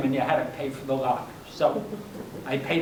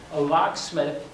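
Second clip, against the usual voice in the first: ordinary delivery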